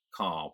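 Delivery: "Car" as British "cah", no R; silent R. Shown as a demonstration. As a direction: leave the R off "Car". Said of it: "I don't pronounce the R in car".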